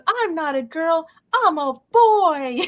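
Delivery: high, slow silly proclamation; husband's hammed-up high-pitched shout; playful announcement. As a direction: crow it out like a goofy grown man. Husband's line retold again.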